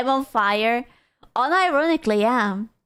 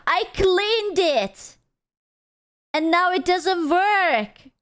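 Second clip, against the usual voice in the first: higher, slow indignant wail; exasperated protest; 6 semitones higher, slower than usual. Real, not acted. Streamer protests broken headset in slow exasperation.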